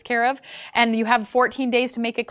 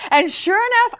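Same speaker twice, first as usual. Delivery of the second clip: ordinary delivery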